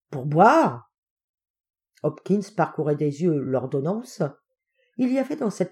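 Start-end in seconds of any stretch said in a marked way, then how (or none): 0.1-0.9 loud, incredulous echo with a big swoop; astonished question. Acted. Helper questioning his master's strange order, astonished. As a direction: balk at it in disbelief.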